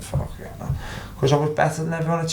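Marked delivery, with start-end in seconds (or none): 0.0-0.7 a soft breathy aside, almost voiceless; muttered under breath. Retold or imitated. Imagined inner reaction, under breath.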